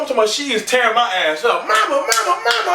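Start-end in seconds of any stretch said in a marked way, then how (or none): 1.7-2.7 put-on very high pleading child voice; high crying kid. Boy begging his mama to stop, high wail.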